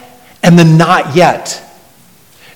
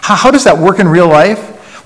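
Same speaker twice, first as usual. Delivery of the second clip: ordinary talk, nothing marked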